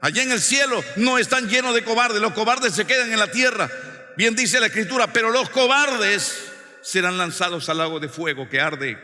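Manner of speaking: high, loud pulpit declamation; full-force preaching. Real, not acted. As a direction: drive each phrase home like a fiery preacher.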